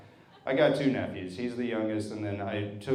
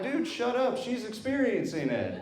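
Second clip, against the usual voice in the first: telling the nephew off; 11 semitones higher than usual. Retold or imitated. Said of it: "And I'm like".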